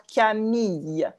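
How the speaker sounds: "Camille" spelled out slowly, ending in a Y sound; slow French Camille. Shown as a demonstration. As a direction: stretch out "Camille" as ka-MEE-y, no L at all. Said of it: "Il n'y a pas de L".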